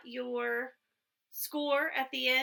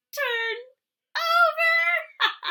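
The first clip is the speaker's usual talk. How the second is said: comic squeal; 8 dB louder, much slower than usual. Acted. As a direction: turn over swooping up very high and loud. Lola describing the stuck beetle.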